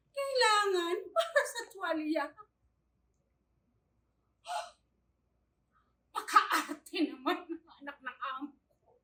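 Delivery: loud, put-upon complaint; exasperated grumble. Acted. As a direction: grumble it out, fed up. Yaya grumbles about employer's spoiled child. (reklamong inis)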